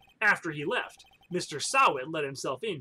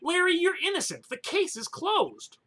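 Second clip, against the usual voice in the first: higher character voice; 10 semitones higher than usual. Acted. Voicing attorney character.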